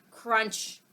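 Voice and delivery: usual speaking voice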